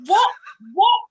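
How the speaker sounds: high, incredulous questioning; really baffled and indignant; exasperated disbelief. Real, not acted. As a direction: blurt each "what" as its own question. Exasperated at woman's tweet.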